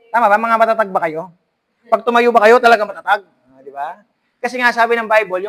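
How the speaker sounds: ordinary talk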